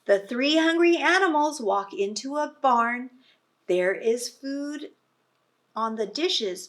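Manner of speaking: lively read-aloud voice; reading to children. Acted. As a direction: read it out big for a class of little ones. Reading picture book to children.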